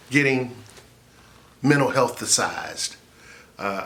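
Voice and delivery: usual speaking voice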